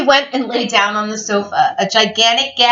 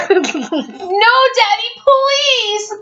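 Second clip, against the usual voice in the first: put-on high, drawn-out child's plea; hammed-up pleading kids; begging; 10 semitones higher, much slower than usual. A narrator's reading. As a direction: beg Daddy in a high whine. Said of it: "begged Betty and Billy".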